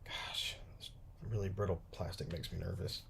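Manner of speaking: breathy nervous whisper. Real, not acted. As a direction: mutter it nervously under breath. Nervous about brittle plastic.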